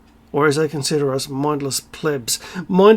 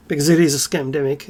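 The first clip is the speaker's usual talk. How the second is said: ordinary delivery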